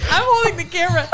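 high-pitched, giggly retelling; laughing while telling. Real, not acted. Mom recalling filming her shrieking toddler, laughing as she talks. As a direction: tell it through laughter.

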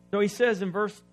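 ordinary talk

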